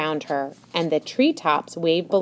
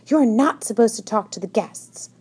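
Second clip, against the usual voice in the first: mild scolding snap, pitched higher; snapped; 5 semitones higher than usual. A narrator's reading. Tilly scolds Mona on the staircase, snapping. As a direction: snap a rule at someone. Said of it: "snapped Tilly".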